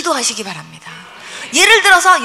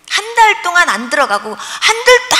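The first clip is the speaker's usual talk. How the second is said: usual speaking voice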